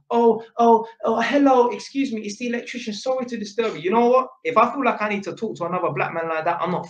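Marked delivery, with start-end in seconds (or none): 0.1-2.2 mock polite caller. 2.2-3.8 mock polite caller.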